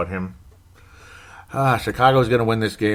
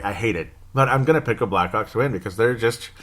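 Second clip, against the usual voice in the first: ordinary delivery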